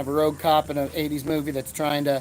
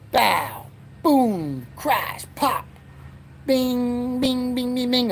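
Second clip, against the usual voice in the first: higher, punchy sound-effect words; 8 semitones higher, much slower than usual. Shown as a demonstration. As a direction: pop each one out like a cartoon effect.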